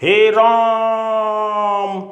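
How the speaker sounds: राम held very long on one high note, like a stage actor; stage-drawn Hey Ram. Acted. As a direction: stretch राम out in a long sung cry. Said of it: "बोलते सीते".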